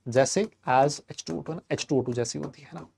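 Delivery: ordinary talk, nothing marked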